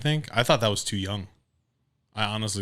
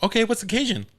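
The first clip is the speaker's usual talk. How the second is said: peppy clerk; 13 semitones higher, 4 dB louder, faster than usual. Retold or imitated. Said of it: "She's like".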